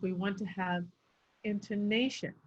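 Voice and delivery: elongated "intonation" after a held pause; pauses for emphasis. Shown as a demonstration. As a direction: pause before "intonation", then draw it out. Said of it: "I'd pause right before that".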